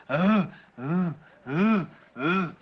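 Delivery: low, drawn-out mournful moaning. Retold or imitated. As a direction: moan like lost souls in torment. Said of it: "and they were going".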